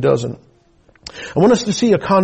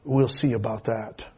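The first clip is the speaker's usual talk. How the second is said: low, much softer muttered aside; a hint of a skeptical mother's murmur; under her breath; 6 semitones lower, 10 dB quieter than usual. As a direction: mutter it like a doubtful wife. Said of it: "she said under her breath".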